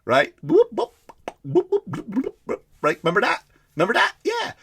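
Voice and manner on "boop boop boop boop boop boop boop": high-pitched rising boops; imitated TiVo beeps. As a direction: mimic an electronic gadget's little bloops. Imitating the TiVo remote's sound.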